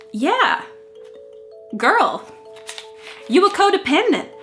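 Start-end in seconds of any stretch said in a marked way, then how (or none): 1.8-4.3 sassy. Real, not acted. Scolding her teen self.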